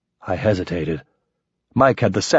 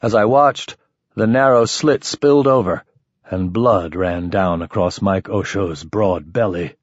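ordinary delivery

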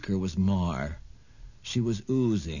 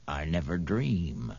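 oily witch voice; 5 semitones lower than usual. A narrator's reading. Mar the witch speaks.

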